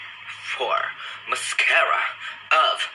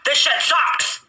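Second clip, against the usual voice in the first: much higher, put-on squeal of frustration; comic frustrated squeal; shrill mock wail; 3 dB louder, slower than usual. Real, not acted. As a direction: shriek it in a cartoonish voice. Outburst at bad mascara.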